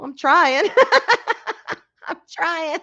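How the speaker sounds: words breaking into real laughter; helpless self-mocking laugh; laughing admission. Real, not acted. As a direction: protest through a rising giggle. Laughs at own shortcomings.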